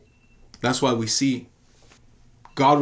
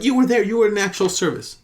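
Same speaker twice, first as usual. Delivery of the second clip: insistent; 7 semitones higher than usual. Retold or imitated. Insisting in retold argument.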